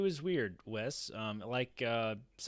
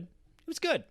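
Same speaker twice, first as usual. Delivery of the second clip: squeaky, much higher take; 4 dB louder, slower than usual. Real, not acted. [name] sums up his rating in a squeaky high voice. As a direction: squeak it out, half laughing. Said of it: "saying it all loud and squeaky like that".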